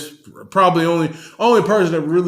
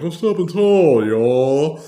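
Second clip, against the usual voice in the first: louder stretched tall, y'all rising; 3 semitones higher, 5 dB louder, slower than usual. Retold or imitated. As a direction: pull them along on tall. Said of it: "it's pulling you along".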